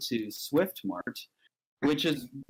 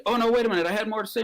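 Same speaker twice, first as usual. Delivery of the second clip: higher, hurried protest; urgent interjection; 9 semitones higher, 4 dB louder, much faster than usual. Acted. Man calls the President back to the phone, urgent. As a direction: stop them before they hang up.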